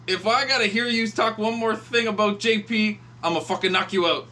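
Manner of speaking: put-on loud, high drunken threat. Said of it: "he gets up and he goes".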